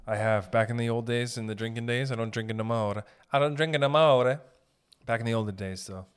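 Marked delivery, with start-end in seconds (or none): 3.3-4.4 emphatic repeat. Real, not acted. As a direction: push it out loud, lifting the last word. Repeats it louder, put on.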